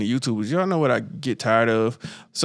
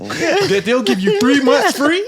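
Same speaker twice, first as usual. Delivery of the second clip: pitched-up, eager mock tip; hyped advice-giver; 9 semitones higher, 5 dB louder than usual. Online tipster on skipping mortgage payments, mock hyped voice. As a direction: imitate an excited online tipster.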